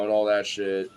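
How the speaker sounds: ordinary talk, nothing marked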